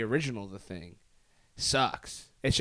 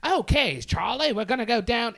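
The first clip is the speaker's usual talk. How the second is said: put-on higher, louder old Hollywood delivery; hammed-up fifties film actor; mock fifties actor's transatlantic line; old-movie transatlantic voice; 12 semitones higher, 8 dB louder than usual. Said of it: "they're like".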